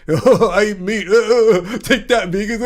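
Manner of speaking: hammed-up caveman taunt, cracking up; dumb meat eater voice. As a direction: taunt the vegans like a proud dimwit. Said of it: "I was doing my dumb meat eater voice".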